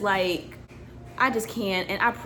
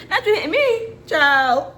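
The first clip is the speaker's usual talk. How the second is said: loud, much higher laughing scold; real laughing protest; 6 semitones higher, 7 dB louder, slower than usual. Real, not acted. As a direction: cry out laughing as she's hit. Baby hits her on camera.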